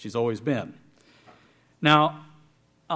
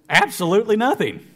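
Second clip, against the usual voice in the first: louder, higher joking dismissal; mock deadpan; 7 semitones higher, 7 dB louder than usual. Pastor teasing congregation, mock dismissive. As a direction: pretend the story was pointless, for a laugh. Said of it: "I'm just joking".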